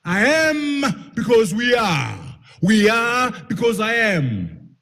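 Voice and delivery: slow, high, loud proclamation of the saying; emphatic proverb. Real, not acted. Speaker declaiming the Ubuntu proverb to the audience, slow and loud. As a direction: declaim it slowly to the room.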